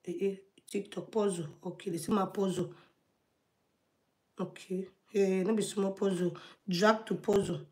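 very low, soft talking to oneself; hushed, low-pitched self-talk with long pauses; absorbed muttering. Real, not acted. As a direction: mutter through a fiddly task, half under the breath. Solving an on-screen puzzle to herself.

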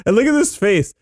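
ordinary talk

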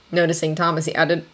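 ordinary delivery

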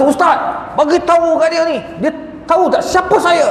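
high, indignant demand. Retold or imitated. Datuk pilgrim demanding the ustaz tell them who he is, indignant and high. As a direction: protest like a man insulted.

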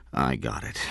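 low, soft, weary reply; tired. A narrator's reading. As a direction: answer wearily, voice dropped. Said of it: "said in a tired voice".

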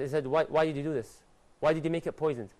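ordinary talk, nothing marked

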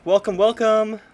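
high, loud, eager welcome; excited greeting. Real, not acted. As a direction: greet the raiders with delight.

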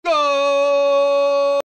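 long loud held GOL; goal shout. Real, not acted. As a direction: belt out the goal.

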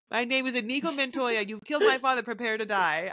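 mock Spanish accent; swordsman's vow in a playful Spanish accent; Inigo Montoya impression. Podcaster repeating a film swordsman's vow, hammed-up Spanish accent. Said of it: "sounded a little Spanish".

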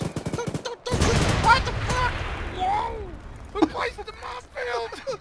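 high, loud yells of alarm; frantic high-pitched shouting; panicked yelling. Real, not acted. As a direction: shriek in shock as it all goes wrong. Sudden chaos during game attack.

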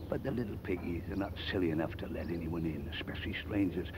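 usual speaking voice

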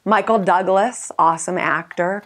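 ordinary delivery

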